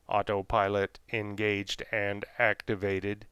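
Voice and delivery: slow, even robotic announcement; mechanical voice. Acted. Ship computer announcing autopilot to Louis and Newport, mechanical. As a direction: intone it like a machine. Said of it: "intoned a mechanical voice".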